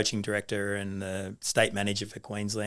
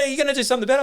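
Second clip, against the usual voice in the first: much higher imitation of the wife pressing him; demanding wife; 4 dB louder, much faster than usual. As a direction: mimic the wife demanding action. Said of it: "And she goes".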